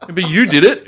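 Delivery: high, loud and laughing; half-laughing kid's whine; laughing protest. Retold or imitated. Kid protesting in retold scene.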